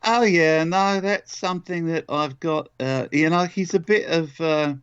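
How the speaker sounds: high-pitched London accent with early-film-star flavour; Whitechapel Charlie. Shown as a demonstration. Actor explains his accent to the interviewer in the bartender's London accent. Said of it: "was that accent s-, one that".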